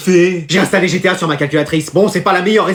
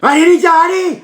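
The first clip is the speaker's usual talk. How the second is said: gamer cheering; 10 semitones higher than usual. Acted. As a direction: urge the team on.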